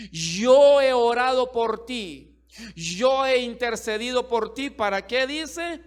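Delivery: loud fervent sermon, high and driving; preaching at full force. Acted. Preacher assuring the church God covers their children, full preaching. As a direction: preach it hard over the crowd.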